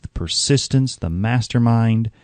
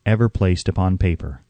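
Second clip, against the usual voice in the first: ordinary delivery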